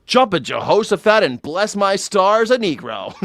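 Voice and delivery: high, hammed-up exclamation breaking into laughter; mock scandalized shock. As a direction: blurt it high like an astonished bigot.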